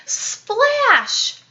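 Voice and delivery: ordinary delivery, nothing marked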